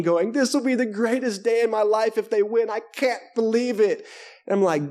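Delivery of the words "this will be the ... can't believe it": high-pitched imitation of a crying fan; whimper-act the overwhelmed fan, lightly; hammed-up tearful gushing; mock tearful. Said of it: "next to his grown son crying".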